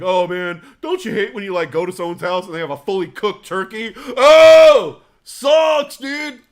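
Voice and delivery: loud, high, whiny complaining bro; mock whiny complaint. Guest complaining about a fully cooked turkey, loud whine.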